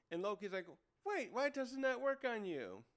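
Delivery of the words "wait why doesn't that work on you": high-pitched mock astonishment; baffled disbelief. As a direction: demand an explanation, comically stunned. Acts Loki baffled attack failed.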